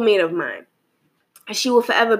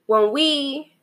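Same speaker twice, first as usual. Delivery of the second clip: higher-pitched opening words; voice got high; 5 semitones higher than usual. Real, not acted. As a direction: let the voice slip up high. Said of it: "my voice got really high".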